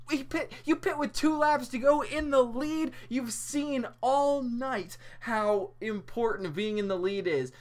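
high, incredulous rant; outraged disbelief at the call; exasperated. Real, not acted. Ranting about pit call.

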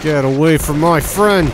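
loud battle cry at the enemies; loud yell at attackers to defend a friend; protective shout. Real, not acted. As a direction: bellow it to drive them off. Fights snails attacking friend.

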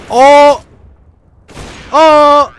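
loud, high yell of joy; excited shout. Real, not acted. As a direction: cheer at the top of your voice. Clutch moment cheering.

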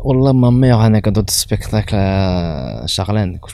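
French North African street accent; rebeu accent. Shown as a demonstration. Comic answering about a best show anecdote, rebeu accent. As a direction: put on a rebeu accent, drawing out the start. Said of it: "et rebeu".

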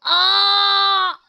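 loud, high held scream; long "ah" yelled at high pitch; imitated scream. Retelling a friend's outburst, mock scream.

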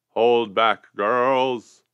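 loud command; shouting. A narrator's reading. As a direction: hold them back with a shout. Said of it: "Tum Tum shouted".

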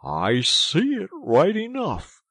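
loud, high, drawn-out words; slow, deliberate. A narrator's reading. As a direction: concede it slowly, unimpressed. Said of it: "he said at last slowly".

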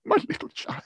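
grief-stricken near-whisper, voice giving out; breaking down. A narrator's reading. Father mourning his small child, breaking down. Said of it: "He broke down all at once".

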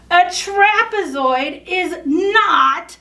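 slow, tearful mock wail; upset protest. Trapezoid protesting his dinosaur costume, slow and tearful. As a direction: whine it out, near tears.

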